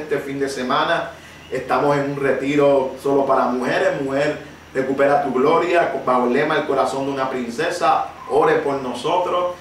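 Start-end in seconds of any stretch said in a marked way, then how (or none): none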